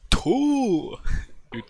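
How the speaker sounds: high, loud bellowing yell like a moose. Retold or imitated. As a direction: bellow it out like Bullwinkle. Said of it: "Sounds like a moose".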